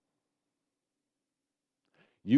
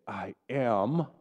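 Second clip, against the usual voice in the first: believing declaration; 4 semitones higher, 7 dB louder, slower than usual. Shown as a demonstration. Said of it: "say it like you believe it".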